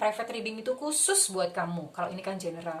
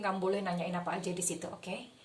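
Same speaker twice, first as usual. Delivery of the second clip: ordinary talk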